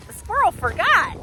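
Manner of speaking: plain reading voice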